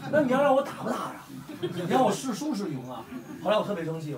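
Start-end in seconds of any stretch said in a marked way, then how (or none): 0.1-3.1 laughing exasperation. Retold or imitated. Mock exasperated reply to rival.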